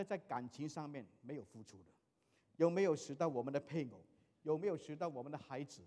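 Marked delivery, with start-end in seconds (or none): none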